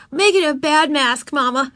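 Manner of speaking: put-on high, pushy child voice; insistent little girl. A narrator's reading. Ramona pesters her mother for a scary mask, insistent child voice. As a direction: press Mama like a demanding kid.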